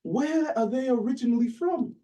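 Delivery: high-pitched imitation of an Oxford don; British Oxford accent. British professor probing a student's family origins, put-on Oxford accent. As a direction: press the point in a mock British accent. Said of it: "this is my best British Oxford accent".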